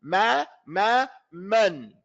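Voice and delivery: "Ma, ma, man" with open a, equal length; correct pronunciation. Shown as a demonstration. Teacher shows the same length of time, correctly pronounced. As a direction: open the mouth fully on each "Ma" and on "man". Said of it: "the same length of time".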